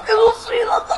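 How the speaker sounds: high wailing cry for help; mock panic. Kid pretends to drown in the pool. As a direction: wail like you're going under.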